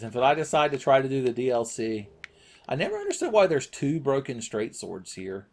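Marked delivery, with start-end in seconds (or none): none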